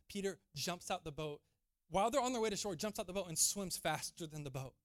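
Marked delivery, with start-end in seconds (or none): none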